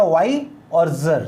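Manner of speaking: slow, separate "वाई" and "जर"; broken into syllables. Shown as a demonstration. Teacher breaks wiser in two, saying "वाई" and "जर" slowly apart. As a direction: sound out "वाई", then "जर", as two units. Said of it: "इसको break कर दिया".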